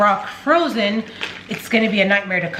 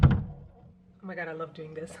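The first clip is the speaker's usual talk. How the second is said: whispered delight; 4 semitones lower, 18 dB quieter than usual. Real, not acted.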